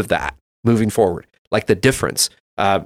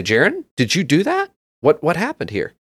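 pitched-up dad quizzing his son; father checking on the kids' fight, higher questioning tone; 6 semitones higher than usual. Retold or imitated. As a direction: ask the boy what happened, voice raised in pitch. Father questions son after complaint.

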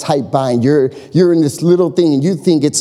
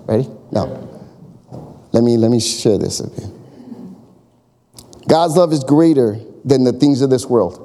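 ordinary delivery

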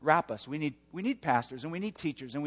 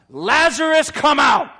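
full-voiced, much higher roar of "Lazarus, come out!"; shouting; 11 semitones higher, 14 dB louder than usual. Jesus calling a dead man from the tomb, roaring. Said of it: "And he shouts".

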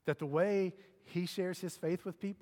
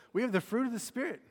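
pitched well up, pushed louder; high and louder; 7 semitones higher, 5 dB louder than usual. Real, not acted. Pastor repeating the line after his voice jumped, higher and louder. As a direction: say it again, even higher and stronger. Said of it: "Ooh, my voice just went way up".

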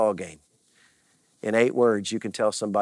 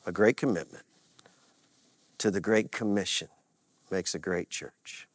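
softly spoken, lower, letting it sink in; quiet solemn refrain; 4 semitones lower, 6 dB quieter than usual. Shown as a demonstration. Pastor quietly repeating the refrain to the church, soft and solemn. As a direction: lay it gently on their hearts. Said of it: "I'm gonna leave you with that".

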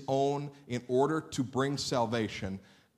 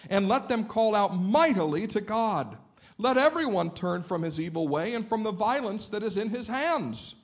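emphatic, higher-pitched Bible reading; scripture reading; 7 semitones higher, 3 dB louder than usual. Acted. Preacher reads of Nineveh's repentance to listeners, declamatory. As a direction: proclaim the story and the royal decree.

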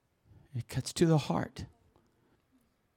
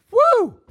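high, loud "woo"; sudden high-pitched whoop; 15 dB louder than usual. Real, not acted. Preacher stumbling over a hard word, lets out a loud whoop.

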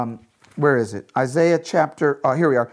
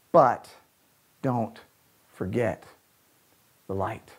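hushed preaching; 4 semitones lower, slower than usual. Real, not acted. Preacher urging listeners to remember the light, hushed. Preacher closes the point softly.

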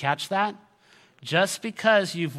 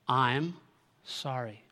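soft, spaced-out condolence; low, careful sympathy; gentle condolence; 3 semitones lower, 3 dB quieter, slower than usual. Shown as a demonstration. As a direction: offer it quietly, one word at a time. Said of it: "I wanna give you two magical words".

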